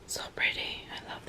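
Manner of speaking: soft admiring whisper. Real, not acted. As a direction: breathe it out in a whisper. Admiring the bag up close.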